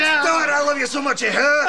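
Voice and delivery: very high, loud sobby gush; hammed-up reconciliation; overwrought affection. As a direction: wail your love until it hurts. Brothers make up hysterically.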